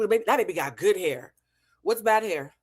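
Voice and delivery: ordinary talk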